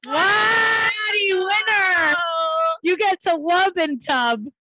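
high, loud celebration; excited shouting. Real, not acted. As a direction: cheer the winner at full volume. Celebrating the winner.

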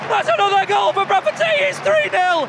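hammed-up match commentary; goal commentary. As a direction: shout it like a radio commentator as the goal goes in.

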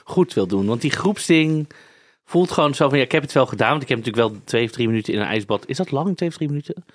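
ordinary talk

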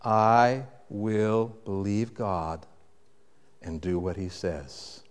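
soft, slow, sincere vow; quiet resolve. Shown as a demonstration. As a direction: say it gently like a prayer. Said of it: "you have to start at this point".

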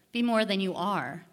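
ordinary talk, nothing marked

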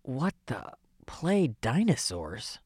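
low, slow disbelief; baffled, drawn-out question; incredulous. Real, not acted. Reporter baffled by odd suggestion.

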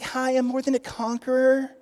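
lightly sweet, not meaning it; sweet and timid. Retold or imitated. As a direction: say it like a meek greeting card. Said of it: "those aren't just sweet terms".

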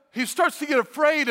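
ordinary talk, nothing marked

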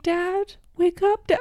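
mock pleading, high little voice; lion cub reenactment. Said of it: "get a live reenactment".

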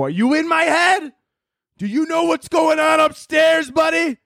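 very high-pitched outraged yelling; indignant shout. Retold or imitated. Yelled retort to the doctor.

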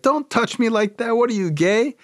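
high-pitched outraged dad impression; indignant recoil. Dad recoiling from Carl's touch, indignant. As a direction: protest as if just grabbed, voice shooting up.

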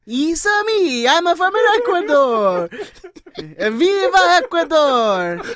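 high, loud, drawn-out mock Italian; Mario-style Italian accent. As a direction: belt it out like a cartoon Italian plumber. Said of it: "It's Italian".

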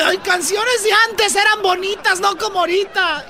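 high put-on voice; high old-timer voice. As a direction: go way up and complain.

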